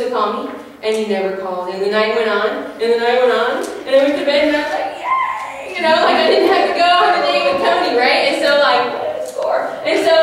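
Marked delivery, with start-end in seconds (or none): 5.0-5.8 squeal of joy. Retold or imitated. Relief at no date.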